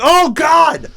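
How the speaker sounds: high, loud exclamation of shock; re-enacted shriek of horror; horrified cry. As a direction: cry out as if finding a grisly sight. Horrified reaction to dead fish.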